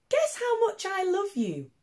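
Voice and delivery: small rabbit, high and bright-eyed, pitched well up; a tiny bunny asking eagerly, voice way up high; little hare voice. Acted. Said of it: "he said".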